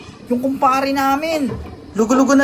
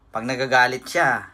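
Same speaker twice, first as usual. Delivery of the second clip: low, quiet hugot talk; bitter love monologue; 9 semitones lower, 4 dB quieter than usual. Acted. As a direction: muse it lowly over a lost love. (mababa at malungkot na hugot)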